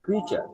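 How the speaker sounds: ক্রিচার as "KREE-cha"; ক্রিচার rhymed with teacher; model pronunciation. Shown as a demonstration. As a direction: say ক্রিচার high then falling, for copying. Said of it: "এটার উচ্চারণ হচ্ছে ক্রিচার".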